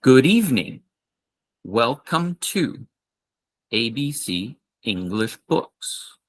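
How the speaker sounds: slow, clear shop welcome; slow model greeting. Shown as a demonstration. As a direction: repeat it slowly for the class. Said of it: "One more time".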